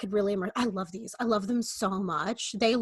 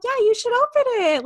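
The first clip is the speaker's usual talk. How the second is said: higher, louder eager push to open it; eager nudge; 6 dB louder than usual. Retold or imitated. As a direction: urge him eagerly, pitched high and loud. Her own nudge retold.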